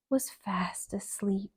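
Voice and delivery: plain reading voice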